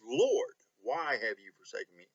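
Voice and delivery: "Lord" lifted sharply above "why have you forsaken me?"; stress on Lord. Shown as a demonstration. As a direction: punch "Lord" hard at the start, then fall away. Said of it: "he goes to Lord".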